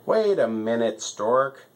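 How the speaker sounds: usual speaking voice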